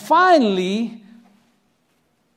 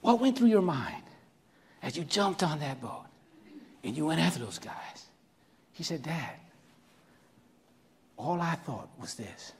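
quiet, much softer, near tears; hushed emotion; 6 semitones lower, 12 dB quieter than usual. Real, not acted. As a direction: let it drop to a moved hush. Quiet emotional exchange with son.

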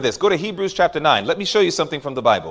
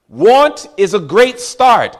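slow preaching emphasis; 3 semitones higher, 5 dB louder, slower than usual. Real, not acted. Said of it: "repeated refrain".

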